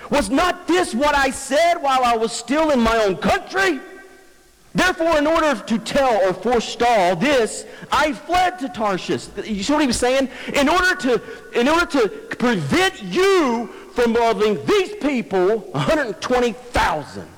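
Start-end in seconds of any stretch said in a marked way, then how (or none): 0.1-9.3 high, indignant complaint to God; Jonah's accusing prayer. Acted. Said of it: "he's attacking God with his own character". 10.5-17.3 high, slow, emphatic grievance; Jonah's accusing prayer. Acted. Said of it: "he's attacking God with his own character".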